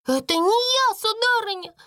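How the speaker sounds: put-on very high, wailing servant girl; acted tearful wail; sobbing protest. A narrator's reading. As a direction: plead tearfully in a high little voice. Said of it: "рыдая, оправдывалась Бекки".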